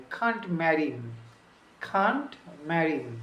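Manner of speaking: "can't marry him" with him said as "im", linked; dropped h. Shown as a demonstration. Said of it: "এইচটা একদম মানে উচ্চারণই হয় নাই".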